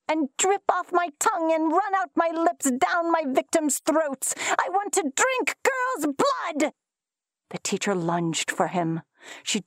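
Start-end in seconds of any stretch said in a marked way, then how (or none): 0.1-6.7 pitched high, climbing to a frenzied yell; wild yell. A narrator's reading. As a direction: hurl it from the corner. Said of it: "he yelled".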